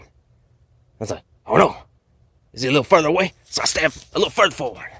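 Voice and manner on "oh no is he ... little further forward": high, raised-voice alarm; mock dismay, pitched up and urgent; retold panic. Man retelling a missed stab at Predator, mildly panicked.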